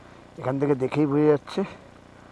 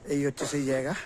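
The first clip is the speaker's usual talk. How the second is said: ordinary talk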